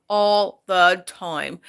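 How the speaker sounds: "All the time" drawn out slowly, each word weighted; slow emphatic repeat. Real, not acted. Mother stresses "All the time" about her son's gaming. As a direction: drag out "All the time" to drive it home.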